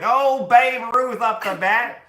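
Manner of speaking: hammed-up vintage ballpark announcer; hammed-up announcer calling Babe Ruth; old-time announcer. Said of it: "They're just like".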